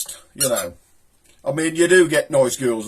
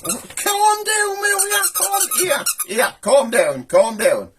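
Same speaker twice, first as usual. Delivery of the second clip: much higher, cooing pet talk to calm the animal; soothing a pet; slower than usual. Real, not acted. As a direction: coo at it to settle it down.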